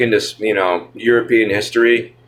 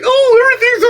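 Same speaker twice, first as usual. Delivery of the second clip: squeaky falsetto mockery; mocking high falsetto; 5 dB louder than usual. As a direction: mimic him in a shrill high voice. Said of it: "He's just like".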